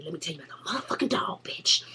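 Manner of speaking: hushed, secretive teaser; soft breathy whisper; conspiratorial whisper. Real, not acted. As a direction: whisper it like juicy gossip. Whispered teaser about the dog.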